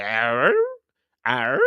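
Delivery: puzzled "arr?" noise swooping up at the end. Retold or imitated. Host reacting to what a contestant said, puzzled noise. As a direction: make a drawn-out, doubting questioning sound. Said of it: "made me go a little bit".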